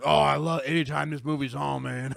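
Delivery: put-on loud, folksy Southern-accented dad. Dad raving about his favorite movie in a Southern accent. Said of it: "my dad does not have a Southern accent".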